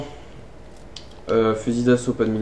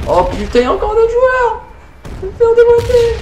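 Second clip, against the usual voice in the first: high, loud panicked wail; panicked shriek; 4 dB louder than usual. Real, not acted. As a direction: shriek in dread of losing. More players appear.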